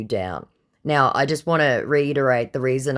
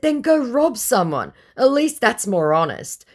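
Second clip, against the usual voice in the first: stern retort; 10 semitones higher, 4 dB louder than usual. Retold or imitated. Scolding the scammer in the retold chat.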